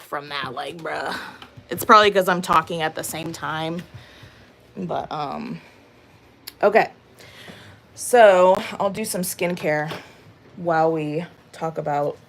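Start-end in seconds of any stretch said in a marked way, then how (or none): none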